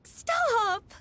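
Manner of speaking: a flustered cry to stop the embarrassing story; flustered protest. Acted. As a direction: cut her off, mortified.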